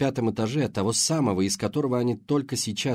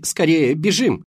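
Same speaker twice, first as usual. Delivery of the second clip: urgent shout; 7 semitones higher, 9 dB louder, slower than usual. A narrator's reading. Kelly urging escape.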